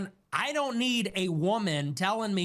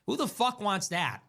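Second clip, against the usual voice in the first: ordinary talk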